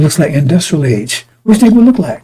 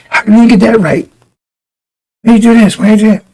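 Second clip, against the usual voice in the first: higher, mock-scolding supervisor; imitating a harsh supervisor; 6 semitones higher, 4 dB louder than usual. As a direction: bark it like a bossy supervisor. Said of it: "how these people, supervisors would talk to people".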